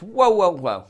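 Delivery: loud, high-pitched whoas; mock halting outburst; acted interruption.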